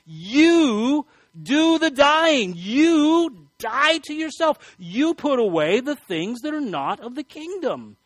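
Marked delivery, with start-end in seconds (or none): 0.1-4.6 slow, high, loud command; emphatic command. Shown as a demonstration. Preacher urging listeners, slow loud command. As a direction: drive each word home as an order. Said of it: "It's a command".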